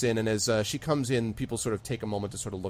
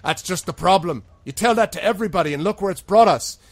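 high, loud heated protest; raised-voice outburst of frustration; exasperated bartender; 8 semitones higher, 9 dB louder than usual. Acted. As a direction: argue back forcefully as the barkeep. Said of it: "Seth sort of shakes his head violently".

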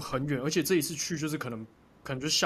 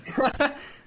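high burst of laughter; 12 semitones higher, 5 dB louder than usual. Real, not acted. 讲妈妈偷哭时大笑.

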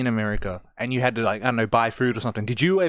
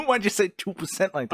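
higher, incredulous teasing; mock challenge; 8 semitones higher than usual. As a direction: pounce on the odd pronunciation, amused. Mocking how co-host said two percent.

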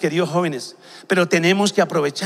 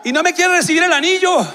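re-enacted shout; 8 semitones higher than usual. Suitor on a scaffold yells about his girlfriend, shouting. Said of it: "empecé a gritar".

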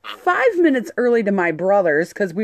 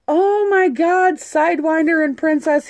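thrilled greeting; 9 semitones higher than usual. Retold or imitated. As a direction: gush over them like old friends. Club member greets them excitedly.

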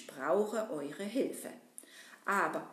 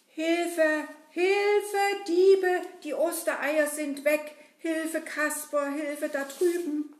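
higher, louder panicked calls; cries for help; 5 semitones higher, 6 dB louder, slower than usual. Acted. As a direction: shout for help, eggs are gone. Hare finds eggs stolen.

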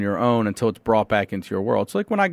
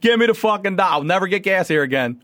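petty outrage; 9 semitones higher, faster than usual. Retold or imitated. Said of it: "I'm like".

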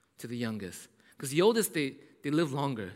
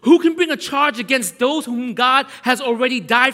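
louder, climbing, full-force preaching; preacher rallying the church loudly; preaching climax; 5 semitones higher, 11 dB louder than usual. Real, not acted. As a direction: build to a shout of triumph.

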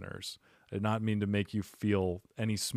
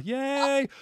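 mock cheer; 14 semitones higher than usual. Real, not acted. Cheering legal weed.

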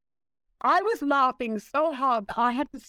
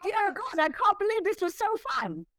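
much higher, gushing with delight; real delight, pitched way up; 10 semitones higher than usual. Real, not acted. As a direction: bubble over about the fun. Gushing about fun at the end.

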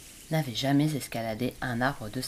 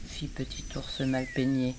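usual speaking voice

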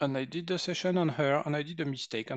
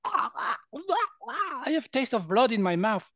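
high, loud mock alarm; coughing, alarmed; 11 semitones higher, 5 dB louder, much slower than usual. Friend coughing in a healing session, acted loud alarm. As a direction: act out a startled man spluttering between words. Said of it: "the guy started coughing".

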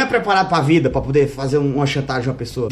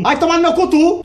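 high, loud furious snap; angry outburst; 10 semitones higher, 4 dB louder than usual. Acted. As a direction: yell it at your twin. Twins fighting.

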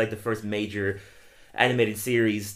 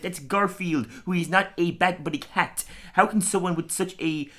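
higher, scornful nerd; higher sarcastic put-down; comic book guy; 8 semitones higher than usual. Retold or imitated. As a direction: sneer at the kid. Comic book guy mocking Ralph.

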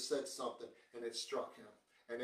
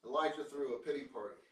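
normal talking voice

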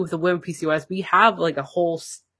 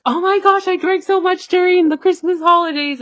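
much higher, louder mock panic; retold panic; 8 dB louder than usual. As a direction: squeal the worry in a hammed-up fret. Panicked recalled thought.

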